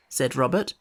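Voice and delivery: plain reading voice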